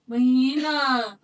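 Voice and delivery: drawn-out call across a crowd. Retold or imitated. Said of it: "calling out to Mahina".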